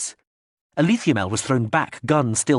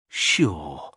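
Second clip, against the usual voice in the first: lower, lazy, half-whispered sure; whispered drawl; 7 semitones lower than usual. A narrator's reading. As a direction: drag it out low like you don't care. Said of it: "in a whispered drawl".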